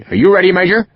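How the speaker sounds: brisk West Country surgeon asking; West Country accent. A narrator's reading. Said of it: "strong West Country accent".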